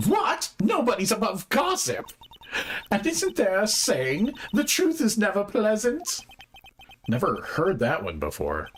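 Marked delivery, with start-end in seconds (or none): 0.1-6.3 put-on high-pitched, indignant old bag; high old-lady voice, conspiratorial; gossipy old lady witness. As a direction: play a scandal-loving old lady, pitched way up.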